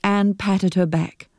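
plain reading voice

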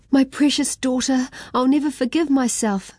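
high, distraught mother; a mum overcome at her daughter's bedside; crying mother. A narrator's reading. Ming's mum at the hospital bed, distraught and high. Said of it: "cried Ming's mum".

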